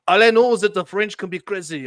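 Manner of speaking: hammed-up fake French accent, loud; a jokey bad French accent to sign off. Host joking about French, in a bad French accent. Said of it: "that's my really bad French accent".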